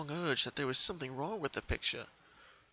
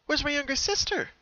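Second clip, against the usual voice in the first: high, loud worried cry; 14 semitones higher, 6 dB louder than usual. Acted. Pinkie searching the picture for her sister, worried and loud. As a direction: call out for the missing sister in alarm.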